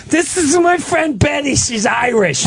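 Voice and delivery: put-on high-pitched old mother voice; put-on high introduction; imitating confused mother. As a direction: pipe it up high, mimicking an elderly mom. Mimics confused mother introducing a friend.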